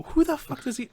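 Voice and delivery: high-pitched mock disbelief; retold bafflement. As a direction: act out your own baffled question. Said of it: "And I go".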